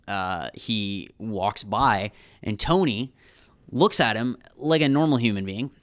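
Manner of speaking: ordinary delivery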